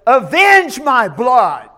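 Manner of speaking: loud, slow demand for vengeance; loud cry. Acted. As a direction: call it out loud, slowly. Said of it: "cried with a loud voice".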